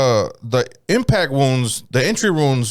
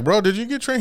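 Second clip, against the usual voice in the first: much higher, curious; pry with a keen high question; eager question; 10 semitones higher than usual. Retold or imitated. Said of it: "I'm like".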